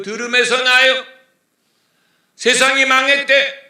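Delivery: full-volume preacher's call; preaching at full force. Acted. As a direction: cry it out from the pulpit. Preacher voices a fearful rumour.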